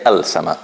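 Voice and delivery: "al sama" with a clear l; lam pronounced. Shown as a demonstration. Teacher repeating the form not to say, lam pronounced. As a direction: sound the l in "al sama" before the s. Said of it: "I don't say al sama".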